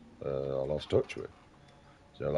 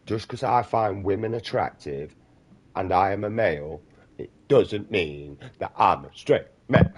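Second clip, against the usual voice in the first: usual speaking voice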